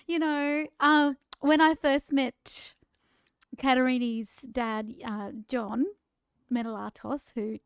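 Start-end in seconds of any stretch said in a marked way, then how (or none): none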